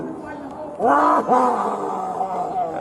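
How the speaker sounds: rising, crying wail of pain; anguished cry. Real, not acted. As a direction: wail out in real distress. Restrained man crying out.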